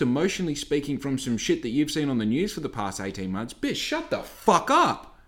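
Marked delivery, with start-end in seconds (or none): 3.5-5.1 much higher, slow, contemptuous shut down; ranting. Retold or imitated. Ranting at a preachy customer, high and scornful. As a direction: cut them off with high disgust.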